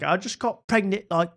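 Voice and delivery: high, mock British girl voice; lightly hammed-up imitation of a chatty Brit; playful mimicry of the influencer; mock Bonnie Blue. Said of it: "she's like".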